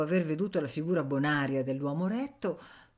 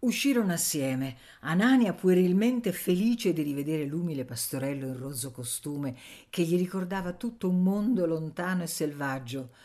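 ordinary delivery

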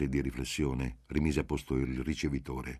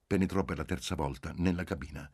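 usual speaking voice

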